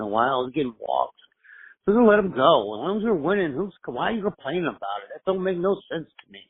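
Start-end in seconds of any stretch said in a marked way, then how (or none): none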